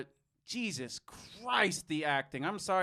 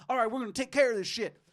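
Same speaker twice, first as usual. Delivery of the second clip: quick, raised southern-accent impression; imitated southern accent; 6 semitones higher, 5 dB louder, faster than usual. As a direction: mimic a serious southern tough guy, louder and faster. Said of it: "kind of a southern accent".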